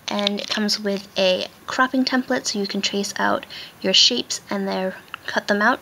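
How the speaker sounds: usual speaking voice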